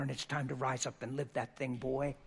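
ordinary delivery

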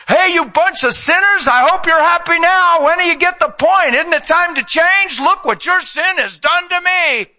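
high, loud, nagging scold of a blamer; mocking scold. Jesus on the cross as preachers wrongly picture him, a high loud scolding sneer. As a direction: sneer it like a guilt-tripping preacher. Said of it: "That's how people preach".

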